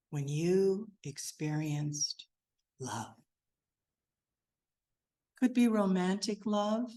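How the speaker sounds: guided meditation. Acted. Guiding listeners to sit back and breathe, calm meditation voice. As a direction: ease listeners inward with a calm voice.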